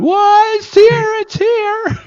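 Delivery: very high, delighted shrieking; excited squeal. Retold or imitated. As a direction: squeal it like the bus just arrived. Band excitedly recalls bus arrival.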